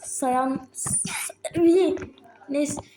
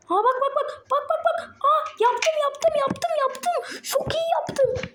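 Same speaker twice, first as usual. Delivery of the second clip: very high, giddy shrieks; excited cheering; 13 semitones higher, slower than usual. Real, not acted. Gamer shrieking as he finally lands the move, overjoyed. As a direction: squeal with joy at the win.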